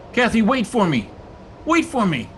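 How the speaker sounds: high, urgent cry into the storm; desperate calling out. Acted. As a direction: call after a lost love. Calling after the lost ghost.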